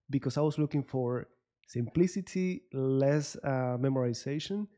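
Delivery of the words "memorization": ordinary talk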